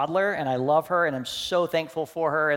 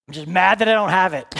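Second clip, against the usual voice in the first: louder, faster, frustrated; a louder, quick, angry confession; angry admission; 6 semitones higher, 11 dB louder, faster than usual. Retold or imitated. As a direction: spit it out fast and loud. His frustrated reply to Jesus.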